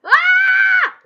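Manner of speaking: sudden high loud shriek; startled scream. Real, not acted. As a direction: yelp as you nearly die.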